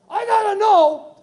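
high, drawn-out mock plea; mock desperate insistence. Preacher mimics people who must know God's plan, mock desperate. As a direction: parody someone who has to know.

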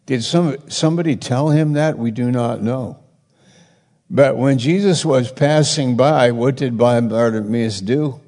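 ordinary talk

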